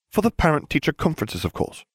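high, prim explanation. A narrator's reading. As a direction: state it primly, as if obvious. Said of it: "Hermione said primly".

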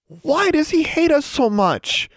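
high, drawn-out wail of complaint; crying out. A narrator's reading. Upset girl to her roommate, crying out in protest. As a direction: cry it out slowly, voice way up. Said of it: "Anna cried".

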